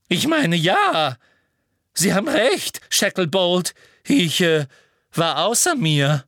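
high, loud, flustered Umbridge; breathless. A narrator's reading. Umbridge apologises to Shacklebolt, breathless and flustered. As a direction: falter through the apology, out of breath. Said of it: "sagte Umbridge atemlos".

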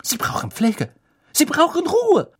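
very high, agitated insistence; flustered, mounting indignation; spluttering protest. A narrator's reading. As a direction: splutter it, climbing to a loud squeal. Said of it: "prustete Madame Pomfrey".

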